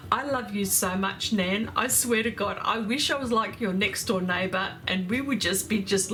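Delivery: ordinary talk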